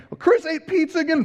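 high, loud mock outrage; mock angry God. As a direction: play an angry God, hammed-up. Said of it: "God is not upstairs saying".

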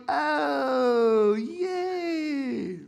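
very high, drawn-out mock cheer; hammed-up high kiddie wail; cheering kids. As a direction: squeal it long like overexcited children. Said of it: "And they're like".

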